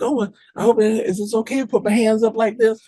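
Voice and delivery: high, put-on innocent woman's voice; mock-helpless, high-pitched asking; acting all innocent. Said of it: "she's acting all innocent and shit".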